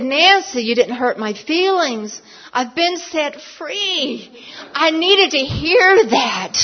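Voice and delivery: high, loud exclamation building into laughter; retold elated relief. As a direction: cry it out as if just set free, drawing words out. Acts out her liberated reply.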